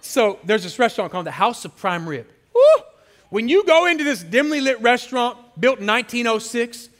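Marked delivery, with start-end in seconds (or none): 2.5-2.8 high, loud whoop of delight; excited whoop. Real, not acted. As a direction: cheer like the food is already here. Cheers for the restaurant.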